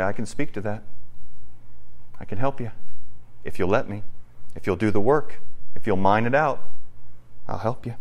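low, soft offer of help; quietly voiced Jesus comforting someone; tender reassurance. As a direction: hush down and reassure gently.

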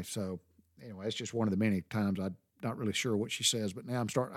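normal talking voice

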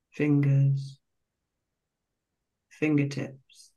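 slow, calm meditation voice with long pauses between phrases; guided meditation. Real, not acted. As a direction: guide listeners gently into relaxation, phrase by unhurried phrase.

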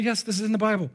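emphatic yes, settling downward; firm confirmation. Acted. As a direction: confirm it as the pastor answering. Pastor answers the imagined question.

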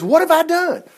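high-pitched, pleading protest; acted bewildered cry; David's distressed plea. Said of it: "And David says".

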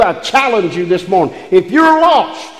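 usual speaking voice

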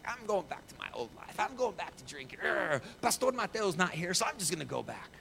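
high-pitched huffy retelling with a growl; mock mad. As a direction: act out her mad, defiant outburst. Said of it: "she's, like, kind of mad".

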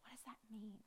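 unvoiced hushed question; whisper. Preacher to congregation, soft whispered question. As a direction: lean in and breathe the question.

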